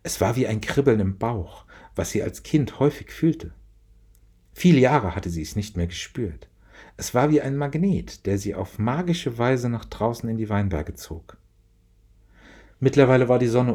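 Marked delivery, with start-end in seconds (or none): none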